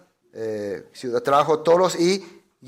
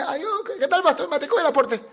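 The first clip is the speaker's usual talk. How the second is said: much higher, fawning mock hello to pastor; mock fawning greeter; 9 semitones higher than usual. Believers fawn over the great pastor, mock high. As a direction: overdo the flattering greeting.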